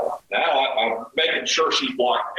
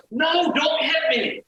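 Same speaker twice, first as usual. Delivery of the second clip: high, slow, shouted cry of fear; mock terrified shriek, pleading not to be hit; frightened plea; slower than usual. Woman on the floor begging him not to hit her, high frightened shout.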